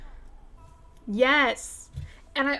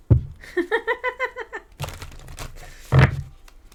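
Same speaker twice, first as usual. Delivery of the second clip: 1 whispery laugh; 13 semitones higher, 3 dB louder than usual. Real, not acted.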